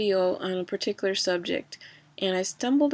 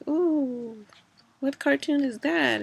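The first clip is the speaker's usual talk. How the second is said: higher, excited child voice imitating the nieces; excited kids; 7 semitones higher, slower than usual. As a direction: mimic a wowed kid seeing a cartoon. Nieces' reaction voiced in story.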